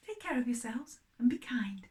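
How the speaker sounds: ordinary delivery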